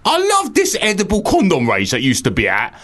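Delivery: high-pitched put-on emailer voice; hammed-up high character voice; Roy. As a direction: read it as an excitable odd caller. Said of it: "I'll just do it in Roy's voice".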